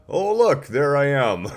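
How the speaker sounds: delighted surprise. Real, not acted. Host spotting herself on camera, happily surprised. Camera suddenly turns back on.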